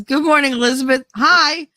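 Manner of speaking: high, bright-pitched hello; peppy morning greeting; cheery greeting. Acted. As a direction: greet her in a high, lifted voice. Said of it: "I totally read that as".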